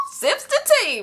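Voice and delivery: high-pitched mock tea-sipping aside; hammed-up gossip voice; gossipy aside. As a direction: play the nosy onlooker, pitched high.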